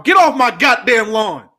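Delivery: mock cranky old man shouting; grumpy old man. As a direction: yell it like a cranky old neighbor. Grumpy old man rant about kids.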